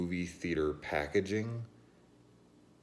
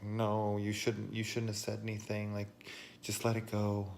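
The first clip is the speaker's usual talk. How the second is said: higher, prissy imitation of scolders; mock scolding crowd; 8 semitones higher than usual. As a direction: mimic the onlookers' nagging. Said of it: "they were just like".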